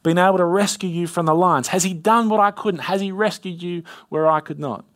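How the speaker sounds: high, loud anguished cry to Daniel; anguished call. A narrator's reading. King calling down into the lion's den, anguished. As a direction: call out in anguish, fearing the worst. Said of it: "he called to Daniel in an anguished voice".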